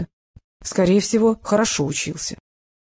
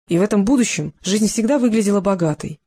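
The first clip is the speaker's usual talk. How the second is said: ordinary talk, nothing marked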